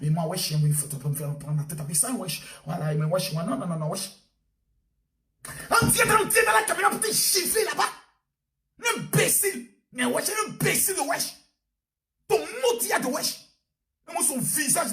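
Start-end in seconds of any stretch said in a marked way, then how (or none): 0.0-4.1 lowered lazy wesh voice; low mocking slang daughter. Mocking the daughter dodging her mother's questions, low and lazy. 5.7-13.4 furious shout. Real, not acted. Preacher rages at the migrant in the camera, shouting high.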